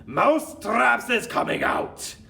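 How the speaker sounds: slow, high, loud witch's proclamation; theatrical villain shout. A narrator's reading. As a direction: bellow the big announcement word by word. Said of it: "raised her voice louder than ever and shouted".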